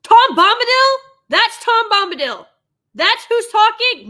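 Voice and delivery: loud, high-pitched disbelief; astonished outburst. Real, not acted. Viewer realizing who is talking in the trailer, loud astonishment. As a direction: cry it out, scandalized by the discovery. Shocked recognition of character's voice.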